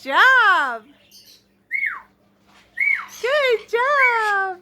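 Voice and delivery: excited praise. Real, not acted. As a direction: cheer the good dog on. Praising the dog.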